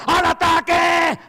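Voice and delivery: full-throated charge shout, slow and high; rallying war cry; battle cry. Acted. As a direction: yell the charge to the troops. Battle cry before the sermon.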